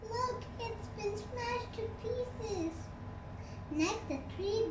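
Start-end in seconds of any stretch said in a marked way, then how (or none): 0.0-2.9 higher, much softer and slow; a small sad cub voice, pitched up and hushed; hushed, high, slowly dismayed little bear; upset Baby Bear. A narrator's reading. Baby Bear sees smashed chair.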